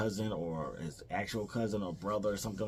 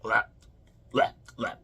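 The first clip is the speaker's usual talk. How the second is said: mock retching; 3 dB louder than usual. Buzz teasing Kevin with fake gagging. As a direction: fake gagging sounds, half-whispered. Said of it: "So Buzz starts all like".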